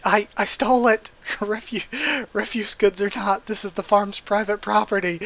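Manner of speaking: a voice-acted demon caught stealing, flustered; panicked demon. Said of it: "and he's freaking out".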